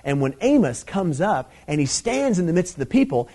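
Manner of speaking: ordinary talk, nothing marked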